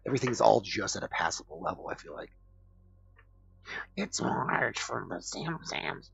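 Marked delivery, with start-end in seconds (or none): 4.0-6.1 strained cartoon wife impression, slow and drawn out; Marge. Streamer imitates Homer's cartoon wife, Marge impression. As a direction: stretch it out, leaping high and loud in a put-on cartoon voice. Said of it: "this is my impression of Marge".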